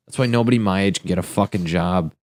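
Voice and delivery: ordinary talk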